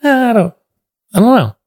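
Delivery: high, loud, doubtful whine; mock squeamish doubt. Podcaster hearing his own voice played back, comic squeamish doubt. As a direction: pitch it way up, unsure and put off.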